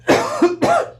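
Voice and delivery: sharp high choking cough; coughing on something down the wrong pipe. Real, not acted. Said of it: "Something went down the wrong pipe".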